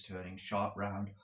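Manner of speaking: plain reading voice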